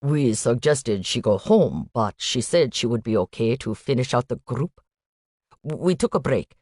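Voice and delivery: put-on light Indian accent, statements lifting at the ends; a hint of an Indian student, worried uptalk. Student telling the agent about Noelle, light Indian accent. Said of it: "a young man with an Indian accent".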